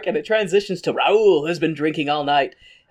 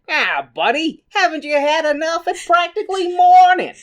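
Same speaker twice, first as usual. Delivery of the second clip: put-on high, loud American wise-guy accent; hammed-up American barkeep, pitched way up; American bartender; 12 semitones higher, 6 dB louder than usual. Bartender to drunk Raoul, exaggerated American accent. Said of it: "the bartender is like".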